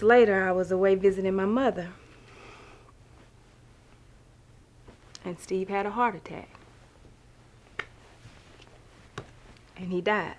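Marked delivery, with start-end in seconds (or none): 5.2-10.3 soft, halting, with heavy pauses; hushed sorrow; subdued grief. Acted. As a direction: break the news quietly, barely wanting to say it. Recounts her husband's sudden death.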